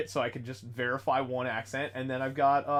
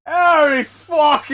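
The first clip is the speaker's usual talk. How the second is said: high, loud, botched British accent; bad British accent; 12 semitones higher, 15 dB louder, much slower than usual. Shown as a demonstration. Showing friends his British, loud bad accent. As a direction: blurt out a terrible British accent at full volume. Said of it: "you want British?".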